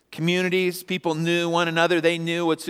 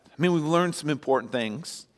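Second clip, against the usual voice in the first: ordinary talk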